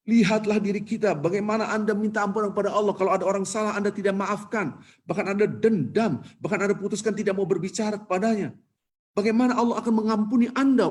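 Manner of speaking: high, urgent sermon exhortation; impassioned preacher. Retold or imitated. Khotib challenging pilgrims who will not forgive, impassioned. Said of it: "Sampai menangis khotib itu".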